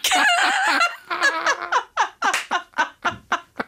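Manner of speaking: shrieking burst of laughter; a high-pitched real laugh. Real, not acted.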